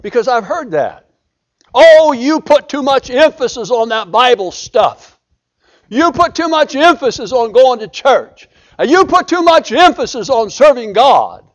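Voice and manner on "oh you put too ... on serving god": scornful quote of a critic, pushed out louder; mocking critic. Preacher mocks people who say he overdoes church, scornful. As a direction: wave it off like a dismissive critic.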